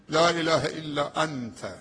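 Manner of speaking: slow, drawn-out chanted recitation; Quranic recitation. Acted. Preacher recites the prophet's prayer to the congregation, slow and chanted. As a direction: stretch each word as in prayer.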